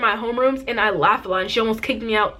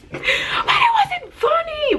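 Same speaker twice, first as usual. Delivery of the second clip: very high, drawn-out shriek; mock protesting squeal; much slower than usual. Mock protest at friends laughing.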